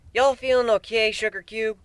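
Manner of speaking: a hint of a concerned Southern mare; Applejack. A narrator's reading. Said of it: "Applejack asked, concerned".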